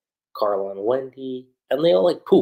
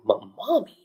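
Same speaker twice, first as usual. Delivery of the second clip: high, stammered question; retold bewilderment; 9 semitones higher than usual. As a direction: imitate a baffled friend, pitch rising. Retold confusion at the word Mommy.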